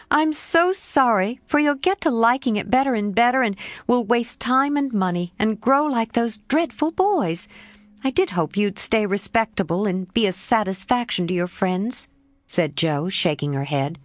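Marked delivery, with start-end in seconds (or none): 0.1-12.1 lightly voiced lively girl, a bit higher; a hint of a frank young girl, pitched up; Jo's voice. Jo answering her friend Laurie, lightly voiced girl.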